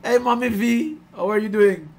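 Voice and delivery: ordinary talk, nothing marked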